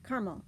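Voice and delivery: "caramel" in two syllables, CAR-mul; syncopated schwa. Shown as a demonstration. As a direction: drop the middle vowel of "caramel". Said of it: "It's a deletion of syllables".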